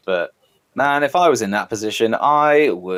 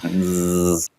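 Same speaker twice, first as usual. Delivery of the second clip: buzzing insect voice; 3 semitones lower than usual. Shown as a demonstration. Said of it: "That was all me".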